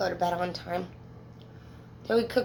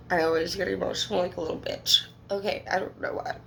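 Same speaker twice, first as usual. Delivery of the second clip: ordinary talk, nothing marked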